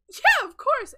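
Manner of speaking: loud, high burst of delight; excited acceptance. Acted. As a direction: blurt it out, overjoyed. Voices Peanut accepting the proposal.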